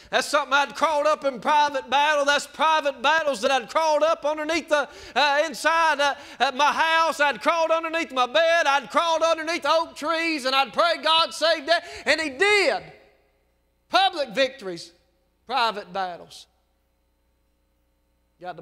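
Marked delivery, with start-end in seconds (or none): 0.1-10.4 loud and high, near hollering; hollering testimony; shouting preacher. Real, not acted. 10.4-16.5 loud, high, fervent preaching; hollering preacher praising God; full-force preaching. Real, not acted. Preaching climax, shouting.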